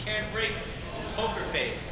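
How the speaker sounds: usual speaking voice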